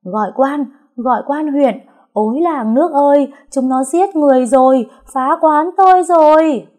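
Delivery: high, loud panicked wailing; wailing shout. A narrator's reading. Wife screaming for help as her tavern is wrecked, wailing shout. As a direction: scream for the magistrate, rising to a shriek. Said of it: "không ngừng la hét".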